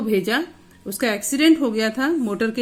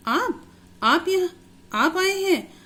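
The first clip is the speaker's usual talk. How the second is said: higher, slow, stammering surprise; surprised; 9 semitones higher, slower than usual. Acted. Injured boy astonished to see his visitor, stammering surprise. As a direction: stumble over the words in astonishment. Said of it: "एकदम surprise हो जाता है".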